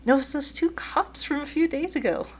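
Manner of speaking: high-pitched urgent protest; alarmed reply. Acted. Player character spots police while hiding.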